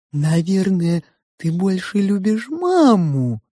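slow, wheedling sow voice; insinuating pig. A narrator's reading. The pig probes the girl's love for mother, insinuating. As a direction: ask slyly, stretching the words. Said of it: "вкрадчиво спросила свинья".

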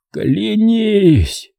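high, strained, drawn-out command; hoarse rasp. A narrator's reading. Dying father demands his son's oath, rasping hoarsely. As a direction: force out the demand with failing breath. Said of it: "прохрипел отец".

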